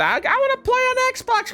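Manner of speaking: put-on high, whiny little-kid voice. Parent voicing whining kid.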